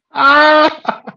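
high, loud squeal of amusement; real high-pitched laughing shriek; delighted squeal. Real, not acted. Delighted reaction to the insult.

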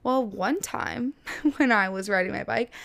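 ordinary talk, nothing marked

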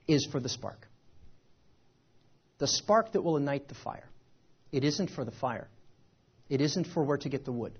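low, hushed, deliberate delivery; solemn motivational message. Real, not acted.

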